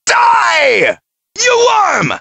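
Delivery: high shouted attack; fierce fighter's shout; battle cry. Acted. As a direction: yell it as you strike.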